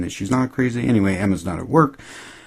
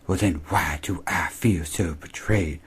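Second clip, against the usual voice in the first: slow, whispered and softer, imitating a hurt girl; mock wounded whisper; 4 dB quieter, slower than usual. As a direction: breathe it out as if deeply betrayed. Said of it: "pure Emma fashion".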